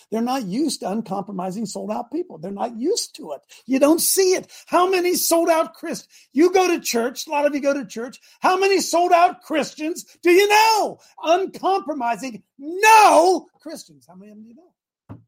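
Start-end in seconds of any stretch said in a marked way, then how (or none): 3.7-14.1 high, loud preacher's challenge; fired-up rhetorical shout; impassioned preaching. Real, not acted. 14.1-15.2 suddenly very soft, pointed question; quiet rhetorical question; hushed challenge. Real, not acted. Sudden hush after the shouted challenge.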